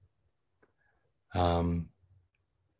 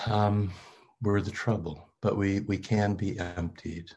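usual speaking voice

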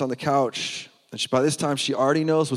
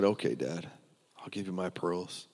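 soft, lower, resigned giving in; sighing surrender; 5 semitones lower, 10 dB quieter than usual. Retold or imitated. Daughter giving her dad the pearls, softly sad and resigned. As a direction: let it go with a heavy sigh, much softer. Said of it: "So with a big sigh".

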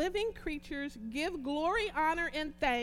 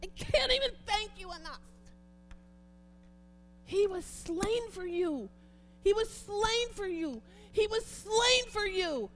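loud, higher, fervent thanksgiving; preaching at full force; 7 semitones higher, 9 dB louder than usual. Acted. Preacher crying out thanks to Jesus before the church, fervent and loud.

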